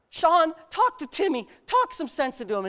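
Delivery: retold pleading mom. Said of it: "his mom, go".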